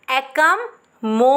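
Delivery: Accommodation said slowly; slow model. Shown as a demonstration. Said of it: "अब आपको इसको कैसे देखना है". (धीरे धीरे)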